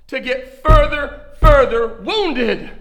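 loud and very slow, hammered words; loud, slow emphasis. Acted. Preacher telling the church of his wound, loud and slow. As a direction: drive home the hurt.

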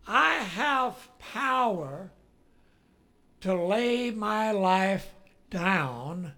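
slow, emphatic scripture declaration, starting loud; solemn proclamation. Acted. Preacher quoting Jesus' written words to the congregation, slow proclamation. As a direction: declare it word by word, easing off toward the end.

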